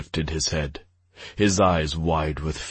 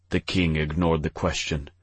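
ordinary delivery

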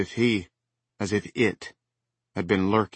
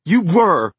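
high, loud accusing shout; 11 semitones higher, 11 dB louder than usual. Acted. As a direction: yell it at him. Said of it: "Rachel began".

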